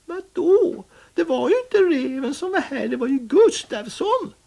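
high, loud, fast protest; a high cat voice, eager to correct; Findus the cat. Acted. Findus the cat corrects Pettersson, high, loud and fast.

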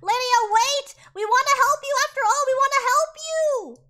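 high, loud urgent plea; pleading call. Retold or imitated. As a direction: call after her, begging to help. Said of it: "And they're like".

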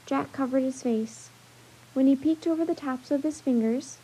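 usual speaking voice

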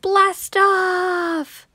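high, thrilled blast-off cry; excited shout. Acted. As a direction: shout it like a rocket launch. Launching jump on couch.

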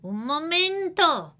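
grandma says wait. Acted. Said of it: "dice la abuela".

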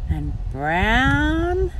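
plain reading voice

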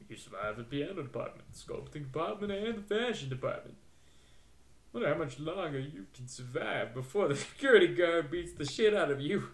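put-on voice sizing up a challenger; taunting game character. Character wondering how long challenger survives, taunting. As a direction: tease them with a theatrical put-on voice.

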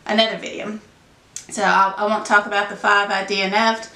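ordinary delivery

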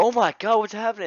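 high, loud hammed-up panic; mock panicked parents. Parents waking to emergency crews, mock panicked cry. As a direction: cry out in exaggerated alarm.

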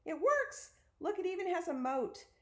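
excited. A narrator's reading. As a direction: exclaim it in high-pitched amazement. Peter thrilled that castle appears.

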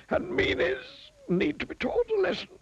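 high wizard warning; a high wizard promising a lesson. A narrator's reading. Wizard warning the miser, high voiced.

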